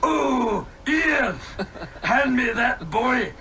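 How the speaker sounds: Fat Bastard voice. Retold or imitated. Joking Fat Bastard wants Anakin, mock Scottish. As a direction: ham up a greedy Scottish glutton. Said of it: "Fat bastard".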